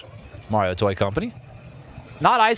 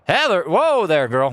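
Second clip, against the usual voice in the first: loud, much higher call of the name; 8 semitones higher, 4 dB louder than usual. Real, not acted. Gamer calling his wandering horse, loud and high. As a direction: yell after a runaway horse.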